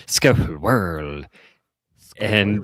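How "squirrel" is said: drawn-out British "Squirrel"; mock British accent. Imitating British chemistry teacher saying squirrel, drawn-out British accent. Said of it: "she was British".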